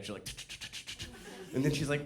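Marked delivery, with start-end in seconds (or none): 0.2-1.1 soft rapid tongue clicks; quiet imitated calculator clicks; mental-math ticking. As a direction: tick like a child silently calculating. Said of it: "doing math in her head".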